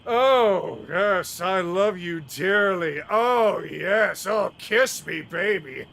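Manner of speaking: loud, high mock swooning. Unseen villain mimics the parting lovers, loudly mocking. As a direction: belt out a hammed-up parody of lovers. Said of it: "that voice that was mocking you".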